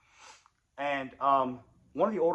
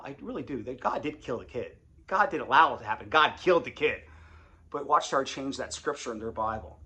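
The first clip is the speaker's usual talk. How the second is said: ordinary talk, nothing marked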